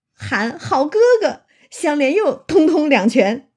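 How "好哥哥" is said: high, loud pained yelp. A narrator's reading. Xue Pan struck again, yelping high and loud. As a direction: cry out as the fist lands. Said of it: "薛蟠哎呦一声".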